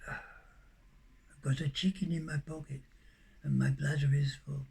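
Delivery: low-key, hushed repetition; quiet, bemused repeat. Real, not acted. Repeats the absurd line to himself.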